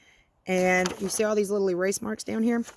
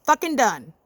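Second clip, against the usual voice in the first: exasperated; 4 semitones higher, 6 dB louder, slower than usual. Real, not acted. Gives up on the math.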